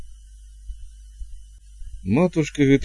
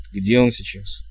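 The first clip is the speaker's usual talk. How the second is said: understated cool cut-in; a touch of royal coldness; cold interruption. A narrator's reading. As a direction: cut them off coldly. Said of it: "холодно оборвал её король".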